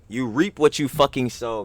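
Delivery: normal talking voice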